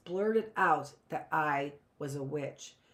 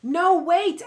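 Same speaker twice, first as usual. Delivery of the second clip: much higher, louder, desperate; cry; 12 semitones higher, 12 dB louder than usual. A narrator's reading. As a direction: cry out to stop her. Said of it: "I cried".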